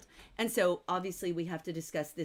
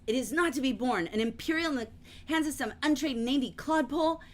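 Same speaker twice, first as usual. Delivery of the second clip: higher, louder haughty protest; hammed-up indignation, pitched up; indignant outburst; 6 semitones higher, 4 dB louder than usual. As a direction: act out an affronted officer's scornful objection. Acts out offended character's protest.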